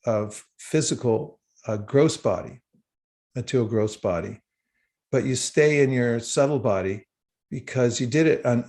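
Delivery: normal talking voice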